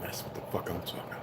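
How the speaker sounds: fast whispered aside. Real, not acted. Streamer muttering agreement to himself, whispered fast. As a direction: breathe it out under your breath, quickly.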